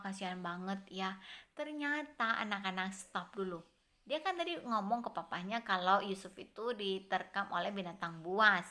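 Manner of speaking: usual speaking voice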